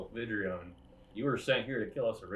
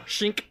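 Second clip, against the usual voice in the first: sound; 9 semitones higher, 3 dB louder than usual. Acted. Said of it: "and just go".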